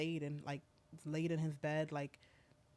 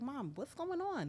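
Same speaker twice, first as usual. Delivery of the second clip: put-on startled child; 9 semitones higher than usual. Daughter woken, alarmed, voiced by mother.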